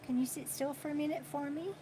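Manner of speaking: hushed, quick plea repeated to the pet; soft coaxing. Real, not acted. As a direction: coax it again, softly and fast.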